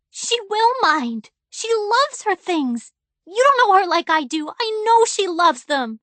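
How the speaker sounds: put-on girl defying her father, high and stubborn; insistent child. A narrator's reading. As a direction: dig in and insist.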